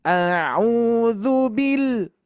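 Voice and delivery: bil ditekan naik lalu turun panjang; stress on bil. Shown as a demonstration. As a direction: tekan suku kata "bil" lalu tahan. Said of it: "ada penekanan ke atas". (tekanan pada "bil", dibaca pelan)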